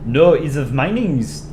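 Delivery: slow, drawn-out mock Danish accent. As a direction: refuse in a put-on, stretched-out Danish accent. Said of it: "should I do a Danish accent".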